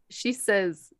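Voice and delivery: ordinary talk, nothing marked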